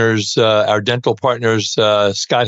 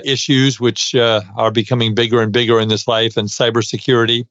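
usual speaking voice

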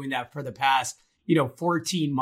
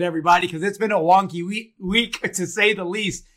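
ordinary delivery

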